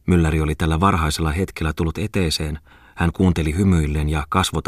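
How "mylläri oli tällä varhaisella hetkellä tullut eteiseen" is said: ordinary delivery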